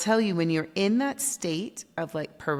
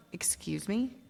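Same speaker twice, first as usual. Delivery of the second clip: softer unvoiced whisper of surprise; whispered disbelief; 3 semitones higher, 7 dB quieter than usual. Answering the coach's boot camp plan, whispered disbelief. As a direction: mouth it in stunned disbelief.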